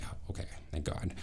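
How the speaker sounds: quick relieved whisper; relieved whisper, rushed; whispered relief. Retold or imitated. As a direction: breathe out relief, fast and hushed. Relief that victim is okay.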